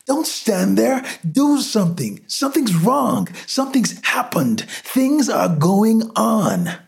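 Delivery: very high, desperate pleading; screaming. A narrator's reading. Frantic man urging the crowd to act, screaming. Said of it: "he screamed".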